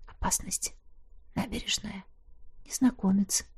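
faint whispered fragments; hushed whisper. A narrator's reading. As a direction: breathe each word softly. Ominous whispered memory fragments.

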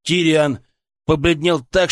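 plain reading voice

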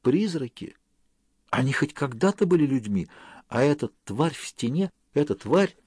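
shaky, cracking voice on the edge; barely holding the voice together; voice breaking. A narrator's reading. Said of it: "Срывающимся голосом".

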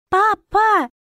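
put-on high, bright child's cry of joy; little girl exclaiming. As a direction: call out as an excited little girl. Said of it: "воскликнула дочурка".

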